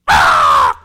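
a sudden loud, low crow caw; loud crow call. Shown as a demonstration. Pastor startles Robert in the dark woods with a loud crow caw. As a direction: blast a crow caw out of nowhere. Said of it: "So I just went".

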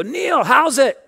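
loud, high-pitched hail; exuberant pastor greeting. Retold or imitated. Pastor greeting the man serving under him, hearty holler. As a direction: call out a big hearty hello. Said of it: "He'd always see me go".

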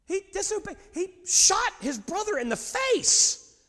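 very high, loud indignant yell; exasperated father at full volume; outraged shouting. Acted. Indignant father re-enacts his outrage.

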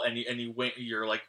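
ordinary delivery

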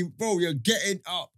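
normal talking voice

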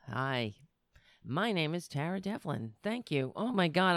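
ordinary talk, nothing marked

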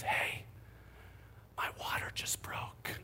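soft unvoiced whisper. Wife waking her husband at night, whispering. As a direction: whisper it gently so as not to startle.

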